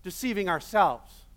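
ordinary delivery

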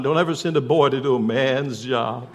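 put-on Southern accent on the punchline. Woman in labor telling God off, Southern accent. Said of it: "I've got this southern accent down".

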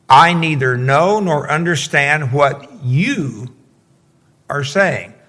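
slow reading, YOU drawn out before a pause; emphatic YOU. A narrator's reading. Preacher reading Peter's reply to the girl, emphatic YOU. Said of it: "is emphatic also".

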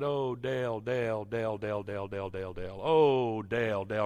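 droning name over and over, sinking in pitch; mock repetitive prayer. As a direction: parody an empty prayer chant. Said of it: "walked up to Dale after church and just said".

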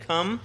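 single out the word come, rising; stress on come. Shown as a demonstration. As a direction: come lifted and set apart. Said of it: "so you can underline".

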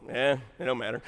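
a low verbal shrug; Adam. Retold or imitated. Youngest son answering church member, low and unbothered. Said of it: "that's Adam depressed".

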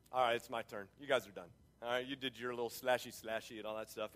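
low, soft, offhand retelling; low-key dismissal. Retold or imitated. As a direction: wave them off quietly, deep in pitch. Acting Elijah dismissing the losing prophets.

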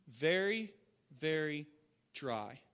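weighty, falling delivery; solemn, spaced out. Real, not acted. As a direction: let it drop gravely word by word. Drawn-out solemn repeat of verse.

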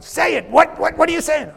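wife pressing her distracted husband, high and exasperated. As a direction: push him to answer. Wife's exasperated voice.

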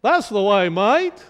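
playful put-on Australian accent. Shown as a demonstration. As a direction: imitate an Australian, lilting up at the end. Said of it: "or Australian".

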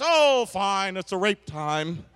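low, mock-resigned giving in; grudging consent. Preacher mimics a spouse giving in, grudging. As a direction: give in reluctantly, dropping low.

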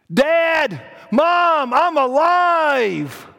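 high, loud cry with long final word; overjoyed shout. Acted. Brian telling Mom and Dad he survived, shouting. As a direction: shout it out to your parents, amazed. Acting boy shouting news to parents.

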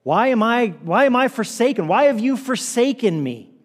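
yelled cry. Acted. Jesus on the cross questions the Father, yelled cry. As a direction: call out loudly, acting out a desperate plea. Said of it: "Jesus yells".

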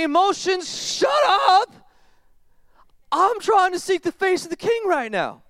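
high, loud shout of "shut up"; shouting at emotions. Acted. Believer yelling at own emotions, high and loud. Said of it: "and say".

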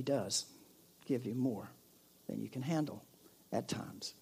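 a hushed confiding whisper. Real, not acted. Pastor whispers a hard truth to the congregation, hushed. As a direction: breathe the words out with no voice.

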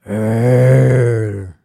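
long imitated lion roar; mock lion's roar. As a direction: roar like a storyteller playing a lion. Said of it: "Leo the lion is now roaring at him".

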